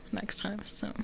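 voiceless whisper under the chatter; dry whispered joke about the apocalypse; whispered aside. Real, not acted. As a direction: slip the remark in under your breath. Deadpan aside to the group.

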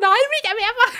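high, loud, laughing refusal; laughing mock panic. Refusing the surgery to staff, high laughing protest.

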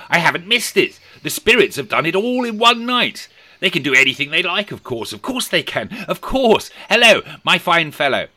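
high, loud, rushing glee; giddy Scrooge. A narrator's reading. Scrooge marvelling at the spirits to himself, giddy and loud. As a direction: babble on in manic delight.

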